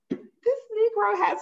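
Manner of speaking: high, slow amused disbelief; chuckling disbelief. Retold or imitated. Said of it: "I chuckled 'cause I was just like".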